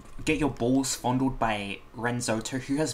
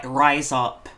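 ordinary delivery

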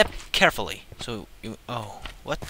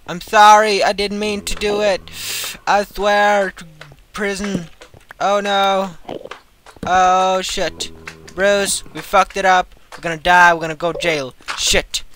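higher and louder mock pleading; hammed-up guilty panic; 7 semitones higher, 9 dB louder than usual. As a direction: beg forgiveness like a cartoon culprit. Fake pleading, fearing jail.

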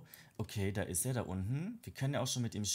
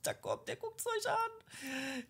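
squeaky cutesy voice; much slower than usual. Real, not acted. Squealing at cute toddler.

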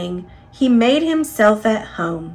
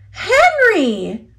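a high sharp cry of Henry; cried out; 10 semitones higher, 4 dB louder than usual. A narrator's reading. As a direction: call Henry out in sudden alarm. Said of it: "cried Missus Brown".

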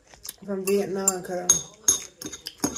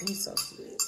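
whispering; 13 dB quieter than usual. Real, not acted. Fond words about the dog.

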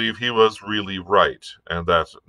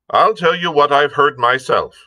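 lightly voiced blunt sea captain, somewhat higher; Captain Smollett; 9 semitones higher, 6 dB louder than usual. A narrator's reading. Captain airing a grievance to the doctor, lightly voiced and firm. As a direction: lightly play a firm, plain-spoken captain.

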